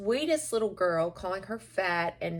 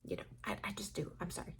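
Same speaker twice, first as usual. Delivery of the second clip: sheepish quiet aside; 15 dB quieter, much faster than usual. Real, not acted.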